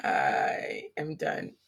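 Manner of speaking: very low, very slow drag; mock done voice. Friend showing she is fed up, deep slow mock voice. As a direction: drop into a deep dragging groan of defeat.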